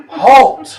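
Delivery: magically amplified command, high and loud; booming voice. A narrator's reading. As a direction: boom it out over the battlefield. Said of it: "the booming voice of the old man".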